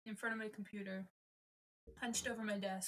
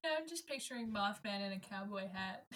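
ordinary talk

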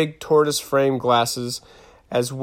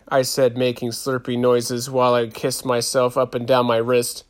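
plain reading voice

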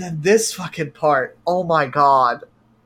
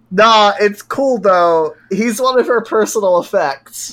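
mock casual; 5 semitones higher, 6 dB louder than usual. She waves away the complaint, mock casual. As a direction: shrug it off. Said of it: "just like".

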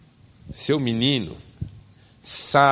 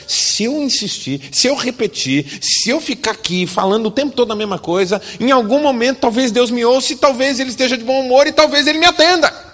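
much higher, louder whiny plea; mock pagan pleader; 13 semitones higher, 8 dB louder than usual. Pagan nagging God, hammed-up high and loud whine. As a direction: parody the nagging believer.

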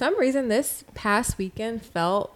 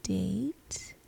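whispered; 5 dB quieter than usual. Real, not acted. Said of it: "Why was that whispered?".

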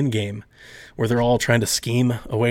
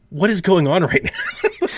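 bewildered; 6 semitones higher than usual. Retold or imitated. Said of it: "I'm like".

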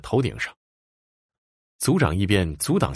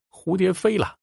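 high, called-out cry; frightened child calling; 11 semitones higher than usual. A narrator's reading. Die'er hiding indoors, calling her ghost friend in fear. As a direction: call out for help like a scared child. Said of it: "忍不住又喊了起来".

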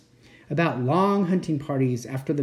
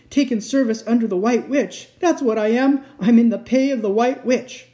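higher, louder guilty outburst; anguished fawn, confessing; 6 semitones higher, 6 dB louder than usual. A narrator's reading. Fawn admitting whom he serves, anguished. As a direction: confess in rising agitation.

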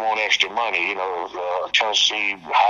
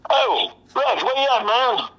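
much higher call across the yard; 12 semitones higher than usual. Retold or imitated. Bounty hunter calling for the hiding bondsman, high call. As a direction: sing out to find the bondsman.

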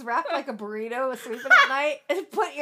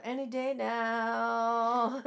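slow, drawn-out any day now; slow, weary inner sigh of impatience; impatient thought; 6 semitones lower, 6 dB quieter, much slower than usual. Retold or imitated. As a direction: stretch it out in mock impatience. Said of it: "I'm thinking to myself".

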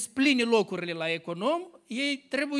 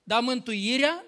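raised, emphatic preaching voice; 5 semitones higher, 6 dB louder than usual. Acted. Preacher proclaiming grace to the church, emphatic and raised. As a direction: proclaim grace to the congregation with force.

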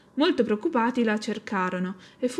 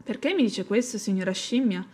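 usual speaking voice

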